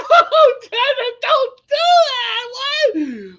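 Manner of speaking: high, loud panicked wail; panicked cry. Retold or imitated. Patient begging doctor Dana, high panicked cry. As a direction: shriek it out in comic panic.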